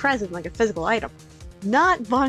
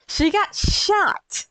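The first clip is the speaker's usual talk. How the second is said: slow, high, disbelieving; stunned shock; 11 semitones higher, 4 dB louder, much slower than usual. Real, not acted. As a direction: drag it out in shock. Shocked reaction to news.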